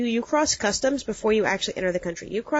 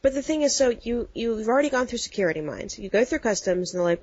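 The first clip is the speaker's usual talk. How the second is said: usual speaking voice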